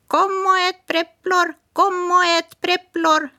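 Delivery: high, far-off parrot call; sing-song lure from a hidden bird; mysterious parrot voice. Acted. Hidden parrot luring Smirke, high bird voice. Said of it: "en röst som pratade tomtiska".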